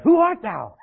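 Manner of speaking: loud, high startled outburst; terrified cry. Acted. Man waking to a stranger at his feet, terrified. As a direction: cry out as if jolted awake in fright. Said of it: "He wakes up terrified".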